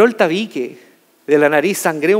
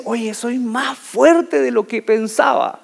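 proud realization; 4 semitones higher, much slower than usual. Retold or imitated. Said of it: "Y ahí uno dice".

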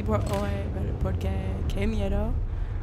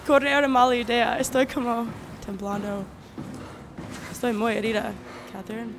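usual speaking voice

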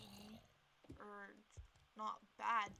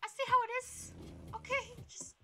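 much higher, louder indignant cry; indignant outburst; 12 semitones higher, 10 dB louder than usual. Real, not acted. Player betrayed by the pet, high indignant cry. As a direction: protest loudly.

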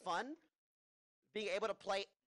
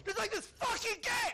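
high, loud frustrated yell; shouting; 5 dB louder, slower than usual. Real, not acted. Gamer yelling mid-fight.

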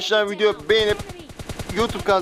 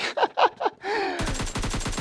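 sudden high-pitched burst of laughter; 14 semitones higher, 5 dB quieter than usual. Real, not acted. Laughing high at an enemy running off. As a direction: cackle at the runaway.

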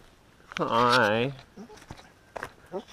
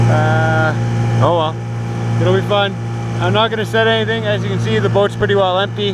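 loud, higher voice over engine noise; talking over noise; 4 semitones higher, 10 dB louder than usual. Real, not acted. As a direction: keep projecting over the motor. Talking over boat motor outdoors.

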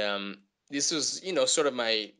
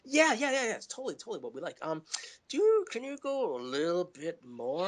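coaxing casters; 8 semitones higher than usual. Retold or imitated. Acts the casters coaxing for more.

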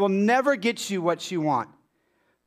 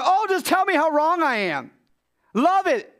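high, mock-happy sarcastic voice; sarcastic delight; 7 semitones higher, 3 dB louder than usual. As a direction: pretend to relish the criticism. Mock delight at being criticized.

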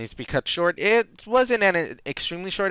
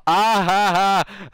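slow, high put-on cackle; clackle; 4 semitones higher, slower than usual. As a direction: laugh it out deliberately, high and slow. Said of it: "you clackle".

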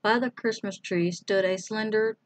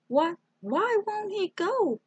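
put-on alarmed little girl; 13 semitones higher than usual. A narrator's reading.

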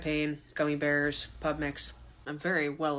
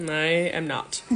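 ordinary talk, nothing marked

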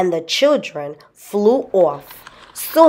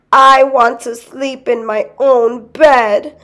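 put-on high, tearful child's whine; crying boy; 6 semitones higher, 4 dB louder than usual. A narrator's reading. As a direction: wail it like a little boy wanting home. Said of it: "the boys began to cry".

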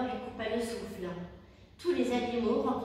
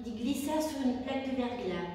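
ordinary delivery, nothing marked

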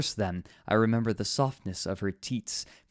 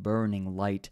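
usual speaking voice

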